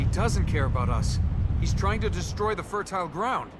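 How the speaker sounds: loud, urgent call. Acted. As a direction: shout against the din. Shouting over destruction.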